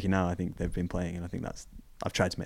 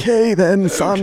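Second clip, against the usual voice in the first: high, louder attempt at a southern English dad; a botched southern accent for his dad; 11 semitones higher, 7 dB louder, slower than usual. Son imitating his southern dad giving permission in a bad southern accent. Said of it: "I can't actually do a southern accent".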